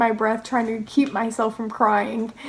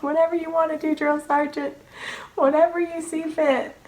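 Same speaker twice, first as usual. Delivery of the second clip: higher, meek mock-crying answer; imitated tearful reply; 5 semitones higher than usual. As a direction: give in like a bawling recruit, lightly acted.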